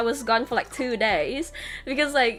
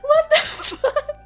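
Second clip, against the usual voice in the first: high, loud, laughing outburst; laughing disbelief; 5 dB louder than usual. Retold or imitated. Traveller reacting to being rushed, laughing high and loud.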